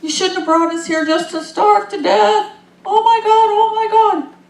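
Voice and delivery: high, loud whiny complaining; moaning and groaning Israelites. Retold or imitated. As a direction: wail it like hungry wanderers blaming their leader. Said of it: "moaning and groaning".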